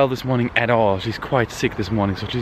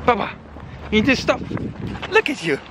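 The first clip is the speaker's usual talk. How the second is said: playful scolding; much slower than usual. Real, not acted. Laughing at misbehaving toddler.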